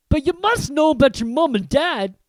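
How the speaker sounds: stunned giant insisting; astonished Hagrid. Acted. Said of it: "he said".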